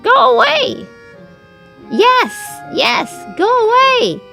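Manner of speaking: very high, loud shrieked chant; shouting. A narrator's reading. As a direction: shout him off the farm. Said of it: "shouted all the animals together".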